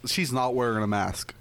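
ordinary talk

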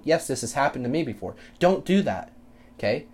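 ordinary talk

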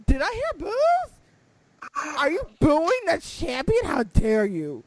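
slow, hammed-up indignant heel voice; drawn-out mock-offended champion; mock outrage. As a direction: scold the crowd with fake offence. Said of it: "I was like".

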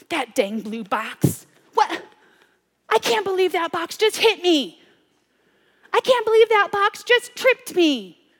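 angry rant. Retold or imitated. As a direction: fume at the box that tripped you. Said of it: "because I'm so angry".

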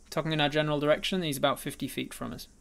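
ordinary talk, nothing marked

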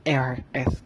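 "R, S" whispered, r not rolled. Real, not acted. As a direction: whisper "R, S" with a lisped r. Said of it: "gue nggak bisa ngomongin huruf setelah huruf Q".

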